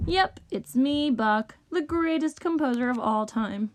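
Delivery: ordinary delivery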